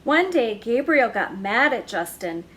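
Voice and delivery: ordinary delivery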